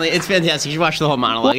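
usual speaking voice

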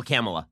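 mock-echo "Kamala" with a flat A as in camel; mimicked mispronunciation. Host mimicking Biden's slip, Kamala as CAM-uh-la, low.

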